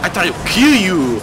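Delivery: huffy mobster brushing you off; mock angry boss. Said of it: "now he's like".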